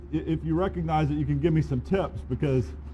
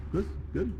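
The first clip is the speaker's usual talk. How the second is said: usual speaking voice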